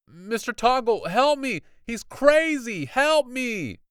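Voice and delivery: high, loud calls for help; frantic yell. Acted. Pinned boy yelling to Mister Toggle for help. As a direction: beg for rescue at full voice.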